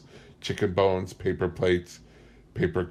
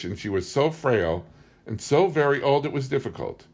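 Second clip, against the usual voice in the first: plain reading voice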